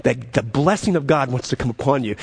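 ordinary delivery

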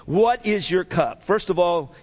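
ordinary talk, nothing marked

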